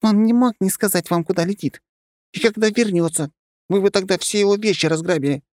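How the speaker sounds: high squeaky creature voice; squeaky mimic. A narrator's reading. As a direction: keep the cross squeak going. Mimic keeps talking.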